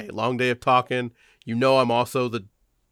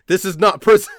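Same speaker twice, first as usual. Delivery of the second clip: higher, louder teacher outburst; sudden angry shout; 9 semitones higher, 9 dB louder than usual. Acted. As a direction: blow up at the student. Teacher snaps at student.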